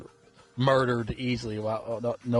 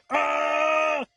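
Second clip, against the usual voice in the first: short high yelled "Ah!"; imitating a lunatic's high scream; crazy scream. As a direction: let out a madman's shriek. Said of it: "he starts screaming like a, like a crazy nut".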